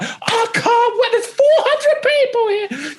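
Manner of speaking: very high-pitched giddy squeal, imitating himself; excited. Comedian backstage at the Comedy Store, giddy excited squeal. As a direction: shriek it like you can barely contain yourself. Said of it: "when I get excited".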